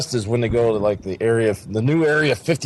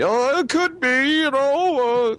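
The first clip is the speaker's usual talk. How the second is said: mock vague witness; slower than usual.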